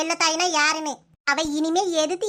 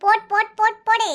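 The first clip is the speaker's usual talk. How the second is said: dismissive shout; 3 semitones higher, 5 dB louder than usual. Acted. Sister snaps back in family quarrel.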